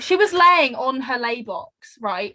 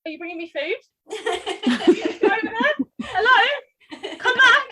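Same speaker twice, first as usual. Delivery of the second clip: high, laughing voice for a perky gecko; gecko calling out; 9 semitones higher, slower than usual. Acted. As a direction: call hopefully after someone, pitched high. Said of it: "then she's like".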